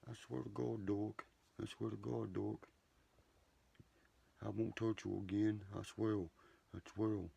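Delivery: mock a scrawny kid swearing he won't touch her again; mocking whiny kid. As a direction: mimic the kid begging him to stop. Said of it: "You sound like a fucking kid".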